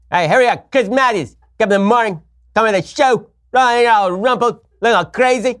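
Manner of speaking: high, loud, hurried TV pundit voice; Chris Matthews impression. Host showing his favorite impressions, frantic pundit voice. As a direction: fire off lines like a frantic pundit. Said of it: "I enjoy doing Chris Matthews".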